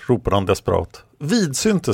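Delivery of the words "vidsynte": high-pitched call back; shouted reply. A narrator's reading. Someone shouts the word back in battle, high shouted reply. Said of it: "skrek någon tillbaka".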